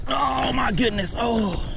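high, soft gasp of amazement; overwhelmed. Real, not acted. Angler marvelling at the fish on his line, overwhelmed.

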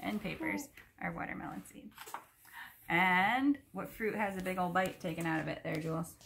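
ordinary delivery, nothing marked

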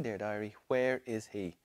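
ordinary delivery

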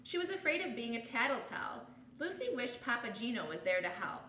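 ordinary delivery

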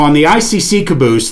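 normal talking voice

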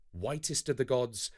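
plain reading voice

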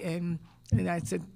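normal talking voice

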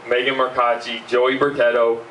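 ordinary talk, nothing marked

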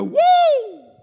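high, loud whooping "Woo!"; church shout woo. Shown as a demonstration. Preacher showing the shout he held back, loud whoop. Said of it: "And I didn't go".

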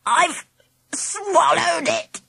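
high, strained, slow croak; choking croak. A narrator's reading. Angela pretending she swallowed the tooth, choking croak. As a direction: squeeze the words out as if choking. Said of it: "croaked Angela".

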